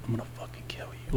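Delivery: imitating a menacing whisper; whispered threat. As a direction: mouth the threat in a voiceless, very soft whisper. Retelling a threat through the window.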